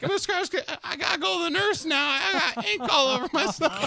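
put-on high kid voice pleading; imitating the inky kid. Teacher mimics inky student asking for the nurse, high kid voice. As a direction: pitch it way up like a desperate student. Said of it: "he's like".

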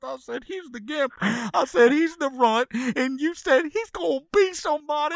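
high-pitched tearful rant; high weepy lament over the turtle; weeping rant. Real, not acted.

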